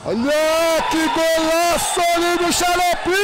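excited shouted goal call. Real, not acted. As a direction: yell it as the goal goes in. Goal scored, commentator shouts.

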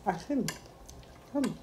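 puckered sour face; chewing while talking. Real, not acted. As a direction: mouth partly full. Seen 0: holds bitten mango, sour grimace, lips working between words at 0.6–0.8.

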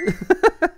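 very high, giggly squeal; high squeal of laughter. Real, not acted.

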